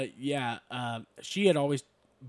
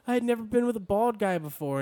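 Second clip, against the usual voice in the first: imitating wife; 11 semitones higher, 6 dB louder than usual. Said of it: "she was like".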